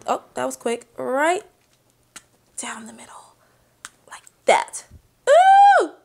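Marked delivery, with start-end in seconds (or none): 2.6-4.8 whisper. Real, not acted. Placing toothpick in strawberry. 5.3-5.9 excited squeal. Real, not acted. Delighted by the strawberry.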